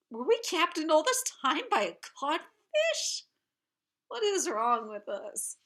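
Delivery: high, incredulous pirate voice, falling back down; bewildered pirates. Retold or imitated. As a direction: act out a baffled crew's dismay. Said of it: "the other pirates into thinking".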